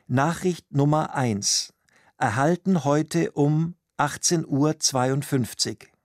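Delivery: ordinary delivery, nothing marked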